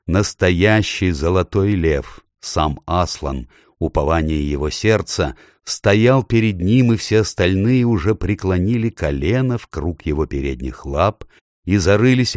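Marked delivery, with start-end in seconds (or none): none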